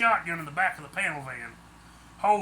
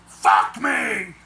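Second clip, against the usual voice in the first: much louder, raw shouted swear; screamed curse; 10 dB louder than usual. A narrator's reading. Harold hears her scream again, loud despairing yell. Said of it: "I screamed".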